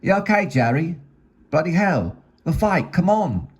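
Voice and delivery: put-on high-pitched British old-timer complaining; protesting pub regular. Bar regulars object to the paused fight, high-pitched protest.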